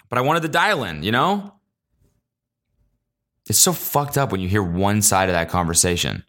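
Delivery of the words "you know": ordinary talk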